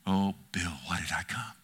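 low, soft, near whisper; sorrowing whisper to the congregation; hushed plea. Acted. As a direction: drop to a breathy plea. Hushed pleading aside.

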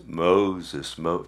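a hint of a deep, booming movie God; lightly put-on solemn divine call; Charlton Heston voice. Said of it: "that sound like Charleston Heston".

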